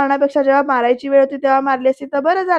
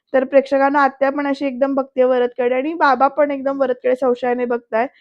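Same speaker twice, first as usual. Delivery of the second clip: ordinary talk, nothing marked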